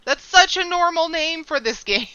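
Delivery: loud high amused surprise; surprised. Real, not acted. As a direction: react with amused disbelief. Surprised by normal NPC name.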